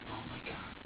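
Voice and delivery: breathless whispered gasp of shock; horrified whisper. Retold or imitated. Mother horrified at her own violent thoughts, whispering. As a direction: let the words fall out on a breath, shaken.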